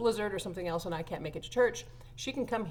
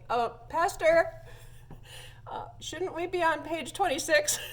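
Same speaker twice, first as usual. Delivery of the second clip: high, raised voice with hesitant breaks; mock-polite call over a balcony; imitated calling out; 9 semitones higher, 4 dB louder than usual. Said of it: "so she yells over the balcony".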